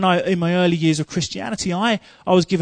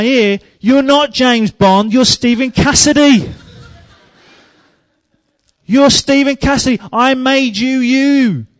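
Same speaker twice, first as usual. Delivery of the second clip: higher and louder, preaching at full force; impassioned preaching; 6 semitones higher, 7 dB louder, slower than usual. Acted. Preacher voicing God's correction with a higher, louder, impassioned shout. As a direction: proclaim it with fire.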